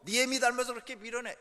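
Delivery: put-on loud, contemptuous jab at the kid; father insulting the child. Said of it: "애 자존심을 막 건드는 말".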